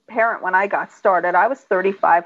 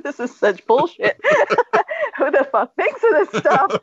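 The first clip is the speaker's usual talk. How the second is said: laughing imitation; 8 semitones higher than usual. Imitates exasperated man amid laughter.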